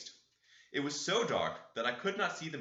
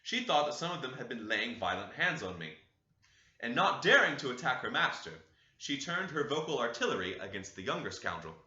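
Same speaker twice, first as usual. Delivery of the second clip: plain reading voice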